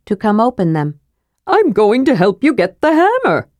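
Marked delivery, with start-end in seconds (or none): 1.5-3.5 high-pitched, eager kender; Tass. A narrator's reading. Kender offers to help get the hammer, eager and high. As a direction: pipe up keenly in a high voice.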